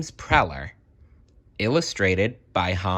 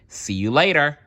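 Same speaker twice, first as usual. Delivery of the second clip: higher bright sign-off; cheerful goodbye; 7 semitones higher, 4 dB louder than usual. Real, not acted. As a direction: wave them off cheerfully.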